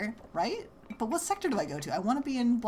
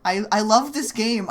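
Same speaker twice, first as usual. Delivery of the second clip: forced cheer through clenched teeth; through gritted teeth; 3 semitones higher, 7 dB louder than usual. Pretend. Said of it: "I say through gritted teeth".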